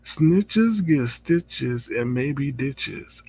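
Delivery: every word drawn out very slowly; slow quoted threat. Retold or imitated. Host repeating Lady Nika's threat, very slow. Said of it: "she said".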